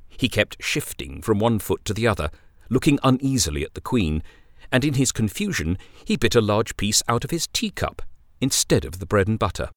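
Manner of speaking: ordinary delivery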